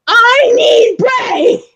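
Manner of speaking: high, very slow crying shout for Bray; demanding shout for a wrestler; whiny yell. Retold or imitated. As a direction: wail like a kid in a tantrum. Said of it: "I'm like".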